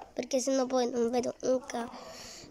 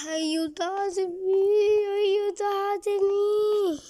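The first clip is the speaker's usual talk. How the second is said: pleading for help; 8 semitones higher, 4 dB louder, much slower than usual. Pretend. Lost in the dark maze.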